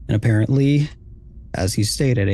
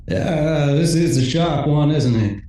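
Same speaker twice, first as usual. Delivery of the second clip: casual, drawling villain voice; Lacan; 4 semitones higher, slower than usual. Acted. Hired gun pointing at the troops, casually mocking. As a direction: toss it off lazily, stretching the first word. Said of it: "Very casually, Lacan says".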